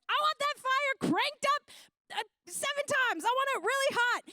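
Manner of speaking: a spoiled king demanding it hotter; temper tantrum. Acted. King ordering the furnace heated, in a high loud tantrum. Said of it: "throws a temper tantrum".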